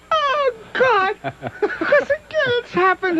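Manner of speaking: high helpless laughter; laughing. Real, not acted. Host laughing at his own story. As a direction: laugh it out, barely able to talk.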